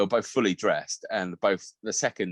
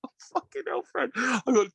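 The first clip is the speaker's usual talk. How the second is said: laughing; 14 semitones higher than usual. Retold or imitated. Cracking up in toilets.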